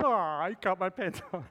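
hammed-up boy's protest; mock crying child. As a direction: imitate a kid crying in outrage. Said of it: "first time Michael's ever cried".

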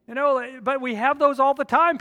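preaching at full force. Acted. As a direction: preach it out to the whole room.

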